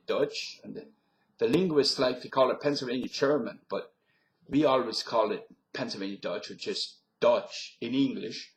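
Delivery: Pennsylvania Dutch local explaining; Pennsylvania Dutch accent. Shown as a demonstration. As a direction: confirm it in German-tinged Pennsylvania Dutch English. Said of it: "this is the accent I often heard there".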